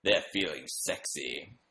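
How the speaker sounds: slow, low mock-seductive read; mock sexy. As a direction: play at being sultry, slowly. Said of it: "a mood ring is always like".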